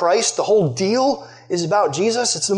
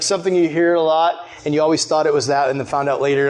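usual speaking voice

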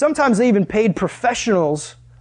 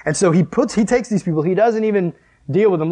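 ordinary delivery

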